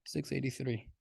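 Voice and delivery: soft, careful redo of the number; quietly correcting the number; quiet retake. Shown as a demonstration. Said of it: "that six was trash".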